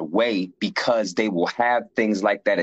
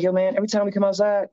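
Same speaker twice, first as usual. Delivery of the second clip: a lightly voiced curious fellow inmate; curious inmate friend; 11 semitones higher, faster than usual. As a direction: voice pitched well up, asking eagerly. Friend voiced in a prison story.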